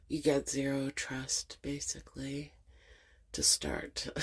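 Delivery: soft whisper; hushed, wry aside. Real, not acted. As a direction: whisper it like a confession. Confiding guarded stance.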